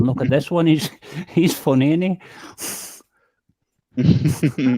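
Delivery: lightly imitating parents tickled by their newborn; a chuckle breaking into a retold remark; amused parents. Retelling parents at the birth, mildly laughing. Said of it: "my parents were laughing".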